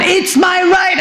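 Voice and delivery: patient shouting at the staff as the doors open; high frantic shout. Retold or imitated. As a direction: shout it high and slow, cut short. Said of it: "I'm still at it".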